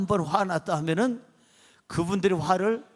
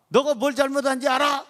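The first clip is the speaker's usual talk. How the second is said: higher-pitched mock scolding; angry father; 6 semitones higher, 3 dB louder than usual. Father confronting his kneeling son, imitated angry demand. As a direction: demand an answer as a cross father. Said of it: "화가 나가지고".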